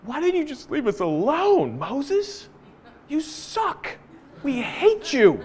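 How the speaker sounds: high, soft mocking whine; sulky teenager voice, pouting; whiny complainers. Israelites sulking at Moses, high soft mocking whine. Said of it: "They're just like".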